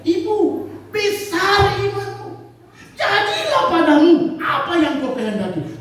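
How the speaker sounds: high, slow, solemn blessing; Jesus speaking. Acted. Jesus answering the woman, solemn blessing. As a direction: proclaim it like a blessing. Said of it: "Tuhan Yesus bilang".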